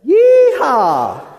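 高声喊出 yeehaw，音调上扬; 带领全场一起大喊 yeehaw; loud yeehaw cheer. Shown as a demonstration. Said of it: "So everybody together".

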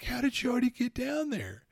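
can't believe he got there first; mock astonished. Dad's astonished question.

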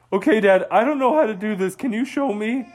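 put-on a kid otter pleading to be shown; eager young otter. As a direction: Otto asking dad how to fish. Otto asks dad for help.

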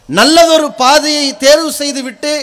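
usual speaking voice

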